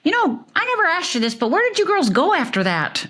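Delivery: a put-on oblivious uncle; imitating the uncle. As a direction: mimic the uncle asking innocently. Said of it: "and he said".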